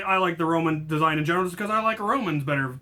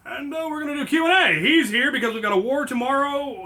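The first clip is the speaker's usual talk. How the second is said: hammed-up announcer; 8 semitones higher, 4 dB louder than usual. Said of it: "hammy announcer voice".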